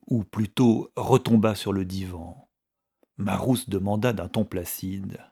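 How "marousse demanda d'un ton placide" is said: ordinary delivery, nothing marked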